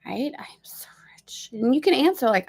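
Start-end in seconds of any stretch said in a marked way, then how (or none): 0.4-1.5 soft secret whisper; hushed private affirmation. Shown as a demonstration. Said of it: "Whisper to yourself your truth".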